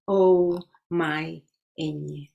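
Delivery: ordinary delivery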